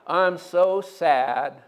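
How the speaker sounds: slow, low, droopy moan; Eeyore-like mope. Retold or imitated. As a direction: mope like a gloomy donkey. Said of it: "you talk like him".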